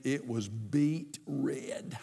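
slow hushed whisper. Real, not acted. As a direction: whisper it slowly in dismay. Shocked at mirror, unvoiced.

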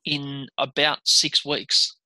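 very slow, deliberate stress on each word; incredulous emphasis. Real, not acted. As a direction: spell it out slowly to let it sink in. Marvels at a punishing schedule.

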